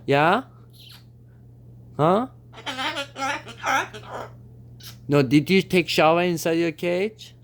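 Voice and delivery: high, sing-song pet talk. Real, not acted. Talking to the parrot.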